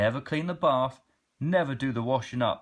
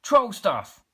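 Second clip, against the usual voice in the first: higher, louder gleeful cry; little troll cheering about troll fun; excited cry; 8 semitones higher, 7 dB louder than usual. Acted. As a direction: shout it like the best idea ever. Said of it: "cried the Grouch".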